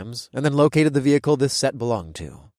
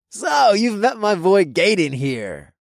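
frat bro voice; 7 semitones higher, 4 dB louder, slower than usual. A narrator's reading. Toby voice.

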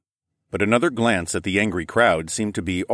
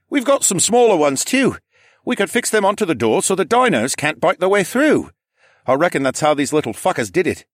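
higher, louder helpful local; Mikey, eager; 10 semitones higher, 5 dB louder than usual. Acted. Mikey tells the group how to fix the door, eager high voice. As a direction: pitch in eagerly with a practical plan.

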